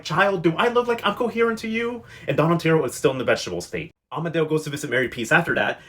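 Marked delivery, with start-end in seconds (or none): 0.0-2.0 high-pitched mock incredulous comeback; lightly acted, high sassy question; incredulous retort. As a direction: imitate a high, incredulous reply. Acting out incoherent old man's retort.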